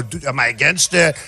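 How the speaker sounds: a hint of a flustered rival; mocking Jeb Bush. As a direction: play him confused and unsure. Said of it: "He didn't know".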